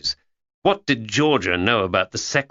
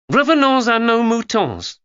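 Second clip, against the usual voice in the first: put-on a higher, louder, clumsy French accent; poor French accent; 6 dB louder than usual. Georgia quotes her French teacher in a poor French accent. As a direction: show off a school French accent badly. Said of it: "in a not very creditable French accent".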